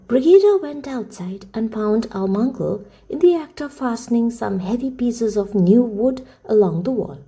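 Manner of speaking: ordinary delivery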